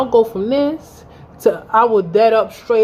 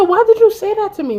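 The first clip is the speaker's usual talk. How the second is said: high, loud demand; hurt and furious; anguished yell; 11 semitones higher, 5 dB louder than usual. Retold or imitated. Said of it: "I said".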